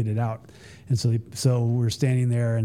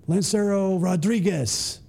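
ceremonial announcer; 6 semitones higher than usual. Retold or imitated. Announcer calls out to jumper.

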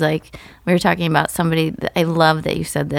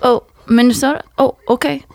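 higher, brisk comedian crowd work; Phil Hamlin impression; 5 semitones higher than usual. Lightly voiced comic on stage quizzing crowd. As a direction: chat up the audience like a stand-up. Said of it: "Do Phil".

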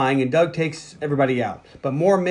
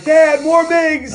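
high, slow panicked cry; 11 semitones higher, 5 dB louder, much slower than usual. Retold or imitated. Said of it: "He's like".

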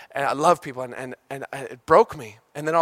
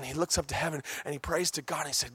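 ordinary delivery

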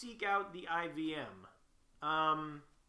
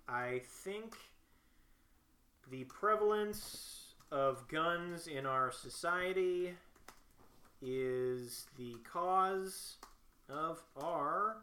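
halting, drawn-out delivery with long pauses; slow dictation; much slower than usual. Acted. Filling in a militia form aloud, slow dictation. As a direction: spell out a statement phrase by phrase. Dictates answer while typing it.

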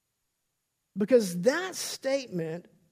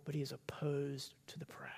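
whispered; 5 semitones lower, 12 dB quieter than usual. Real, not acted. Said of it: "sermon climax".

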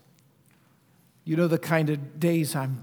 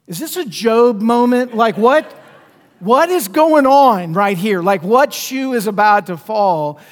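exasperated; 4 semitones higher, 8 dB louder than usual. Retold or imitated. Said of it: "And I'm thinking".

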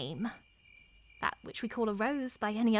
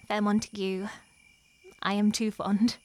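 ordinary delivery

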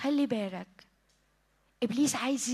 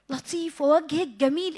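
ordinary talk

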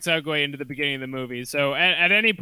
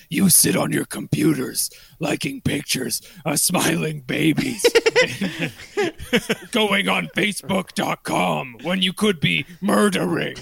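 menacing digital villain monologue; a sinister computer head lecturing; sick hacker voice. Retold or imitated. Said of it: "a sick voice".

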